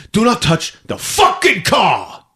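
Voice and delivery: slow high shout peaking on "fucking"; escalating angry warning. Acted. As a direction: explode on "fucking", every word spaced out. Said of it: "he's ready to punch her".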